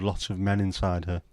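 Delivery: ordinary delivery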